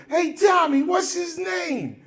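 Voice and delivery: high, slow shout to a partner; cop calling out. Retold or imitated. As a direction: call across to Tommy, high and drawn out. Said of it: "He says".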